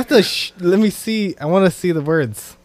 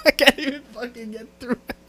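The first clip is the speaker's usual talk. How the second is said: usual speaking voice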